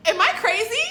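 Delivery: high, loud, rising disbelief; exasperated shouting. Acted. Reacting to his six o'clock answer, exasperated shout. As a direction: shout the question, building in outrage.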